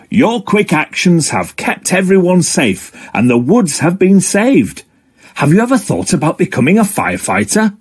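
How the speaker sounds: lightly voiced fire dog, mildly proud; Frankie the fire dog. Frankie praises Octo.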